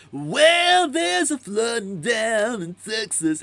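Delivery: high, slow, laid-back surfer voice; surfer dude singer. Retold or imitated. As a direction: croon it like a chill surfer dude. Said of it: "kinda sounds like a surfer dude".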